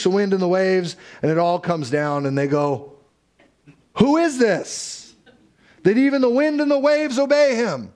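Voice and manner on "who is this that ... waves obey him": awestruck. Retold or imitated. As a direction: ask it in stunned awe. Disciples awed after the storm.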